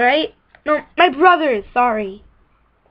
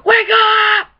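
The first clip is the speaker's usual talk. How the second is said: shout; 6 semitones higher than usual. Acted. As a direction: yell it to wake everyone. Yelling at sleeping people.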